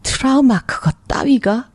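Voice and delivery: high, slow dismissive question; scornful disbelief. Acted. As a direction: scoff slowly at the very idea. Acted sceptic scoffs at trauma.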